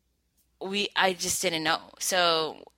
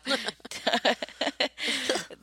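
giggling, caught off guard; embarrassed laugh; 7 semitones higher than usual. Real, not acted. Laugh after tease.

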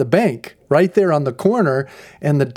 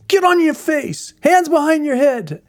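high, loud shouted commands; retold police orders at full shout; cop barking orders; 13 semitones higher, 4 dB louder than usual. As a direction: order him down like an armed cop. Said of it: "and he says".

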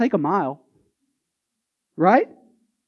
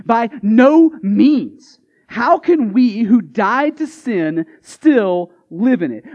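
slow, weighty, emphatic reading; 4 dB louder, much slower than usual. Acted. Preacher reading Paul's answer, slow and emphatic. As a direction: lean hard on each word.

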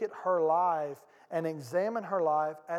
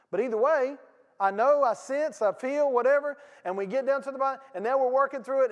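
louder, higher, fast pulpit drive; full-force preaching; 6 semitones higher, 6 dB louder than usual. Acted. Pastor pressing his congregation, full-force preaching. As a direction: push the point hard from the pulpit.

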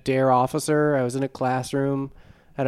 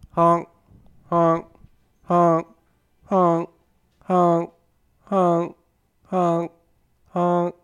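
goose honking; 5 semitones higher, much slower than usual. Pretend. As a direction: honk like a goose, over and over. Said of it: "Geese. They honk".

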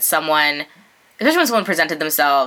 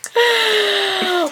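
gasp; 13 semitones higher than usual. Real, not acted. Shocked at friend's cooking joke.

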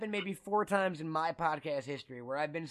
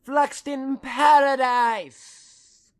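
drawn-out exclaim; 8 semitones higher, 12 dB louder, much slower than usual. Pretend. Dramatic exclaim of movie place.

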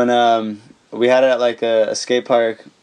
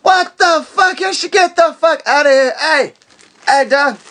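high, loud furious yelling at intruders; startled rage, voice cracking high; angry yelling; 4 dB louder than usual. Retold or imitated. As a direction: scream at the kids waking you up. Woken Smolik yelling again.

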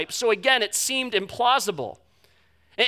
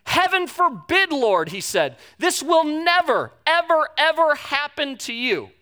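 reprimanding Peter; 6 semitones higher, slower than usual. Retold or imitated. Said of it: "he began to reprimand him".

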